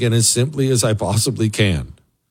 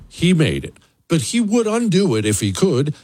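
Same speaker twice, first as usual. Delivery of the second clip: ordinary talk, nothing marked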